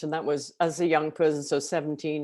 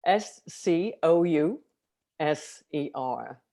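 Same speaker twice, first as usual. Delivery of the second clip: slow, letter by letter; spelling out; much slower than usual. Shown as a demonstration. Spelling Scouser for the interviewer, slow and separated. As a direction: spell it out with spaces between letters. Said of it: "How do you spell Scouser".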